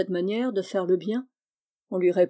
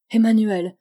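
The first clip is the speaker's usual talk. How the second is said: loud anguished cry of a name; 8 dB louder than usual. Acted. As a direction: call out his name in alarm. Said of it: "s'écria-t-elle".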